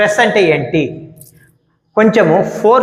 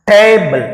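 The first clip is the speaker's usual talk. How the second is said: first syllable of table punched louder, second left weak; stress on first syllable; 2 semitones higher, 4 dB louder, much slower than usual. Shown as a demonstration. Teacher showing students how to pronounce table, first syllable stressed. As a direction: hit the opening టే of table hard. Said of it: "పలికే విధానం చూడండి".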